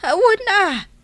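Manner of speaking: high, loud exclamation; emphatic exclamation. Acted. Widowed elder answers startled question. (napigsa a panagikkis)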